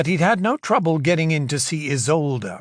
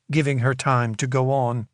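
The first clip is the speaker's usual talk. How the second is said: ordinary delivery, nothing marked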